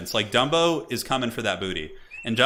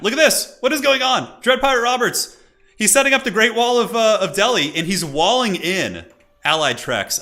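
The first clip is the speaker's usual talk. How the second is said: excited shouting; 10 semitones higher, 7 dB louder than usual. Real, not acted. Caster erupts at surprising wall play.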